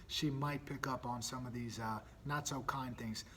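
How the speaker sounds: soft, slow whisper; hushed aside. Real, not acted. Dad whispering about the not so kind things.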